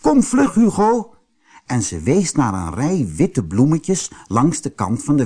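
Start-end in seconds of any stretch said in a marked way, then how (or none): 0.0-1.1 very high, urgent call; girl's high-pitched shout to hurry. A narrator's reading. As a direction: call a friend over quickly. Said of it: "Ze riep".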